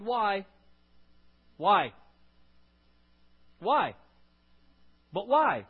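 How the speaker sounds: put-on loud, high nagging child's whine; whiny kid. Child pestering parent with why, loud whine. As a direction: nag like a stubborn kid.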